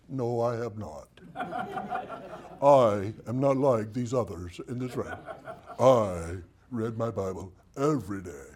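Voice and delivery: low, laughing pious voice; mock self-righteous churchgoer. Preacher mocking a smug churchgoer, low and laughing. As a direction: play the smug saint in the pew.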